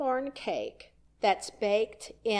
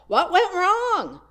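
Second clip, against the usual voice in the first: high, loud, slow alarmed questions; anxious questions; 11 semitones higher, 7 dB louder than usual. Acted. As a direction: voice the panic of someone hit by misfortune. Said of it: "our first questions are".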